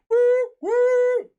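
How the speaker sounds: high, loud hooted train whistle; train whistle whoop. Retold or imitated. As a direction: hoot like a departing train.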